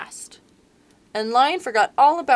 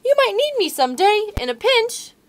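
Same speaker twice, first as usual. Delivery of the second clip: very high, loud squeaky plea; begging mouse; 11 semitones higher, 7 dB louder than usual. Acted. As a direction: squeak and beg. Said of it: "Mouse begged".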